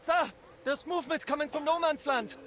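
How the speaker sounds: high, urgent report to an officer; urgent sentry call. Acted. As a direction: call out movement from the trench. Sentry reports enemy movement to officer.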